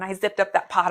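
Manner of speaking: ordinary talk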